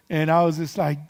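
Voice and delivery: ordinary talk, nothing marked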